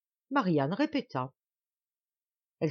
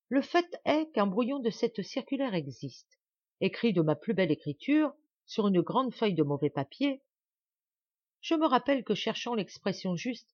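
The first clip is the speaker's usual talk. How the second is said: higher, unruffled, casual recollection; light tone; 6 semitones higher than usual. A narrator's reading. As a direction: recount it airily, unbothered. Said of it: "reprenant son ton léger". (ton léger et désinvolte)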